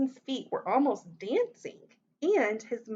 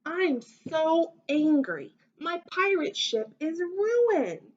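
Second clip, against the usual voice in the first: high, loud, drawn-out storybook yell; indignant child's outburst, acted by a storyteller; angry yell; 7 semitones higher, 5 dB louder than usual. A narrator's reading. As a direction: yell it like an upset child, stretching the words. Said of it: "and yelled".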